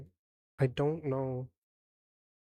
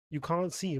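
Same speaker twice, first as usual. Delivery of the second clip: ordinary talk, nothing marked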